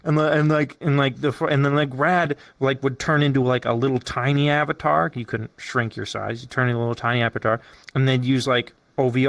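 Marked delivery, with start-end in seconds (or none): none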